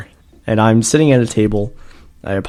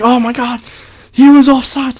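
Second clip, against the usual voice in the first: high, louder mock outrage of rival fans; 14 semitones higher, 6 dB louder than usual. Mimicking Patriots fans in the bar, high mock outrage. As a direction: squeal it like the smug bar guys. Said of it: "These assholes were like".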